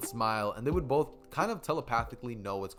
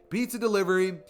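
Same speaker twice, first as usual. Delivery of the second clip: higher, louder bright doorstep call; cheery fake delivery voice; 7 semitones higher, 7 dB louder than usual. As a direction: pretend to be the pizza guy.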